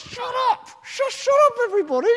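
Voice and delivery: high, loud "shut up!" shout; frantic hush. Acted. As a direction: yell for silence in a panic. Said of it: "It's like".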